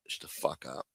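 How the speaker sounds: breathy whispered brush-off; whispered shut up. Real, not acted. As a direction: drop it to a whisper. Fading dismissal.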